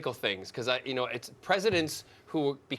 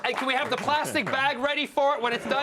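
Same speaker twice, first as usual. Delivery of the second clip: fussy collector voice; 9 semitones higher than usual. Pretend.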